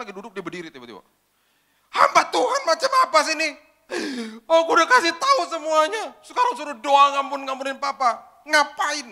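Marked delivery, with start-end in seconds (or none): 1.9-9.1 high, loud furious shout; retold young woman blowing up; raging. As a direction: tear into him in a rage. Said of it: "Oh, ngamuk, ngamuk".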